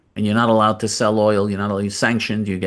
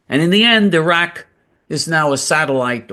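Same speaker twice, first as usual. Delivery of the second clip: much higher and louder, outraged; indignant outburst; 7 semitones higher, 4 dB louder than usual. Real, not acted. Host railing that Iraq ended up an Iranian puppet, outraged and loud. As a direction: let it burst out in outrage.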